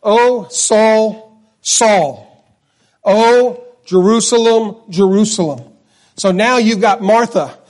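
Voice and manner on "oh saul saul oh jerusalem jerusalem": lament. Retold or imitated. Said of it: "He says it twice".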